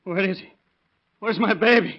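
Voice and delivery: put-on high, panicked cry for the baby; desperate, voice shooting up; panicked father; frantic fear. Father hears of accident.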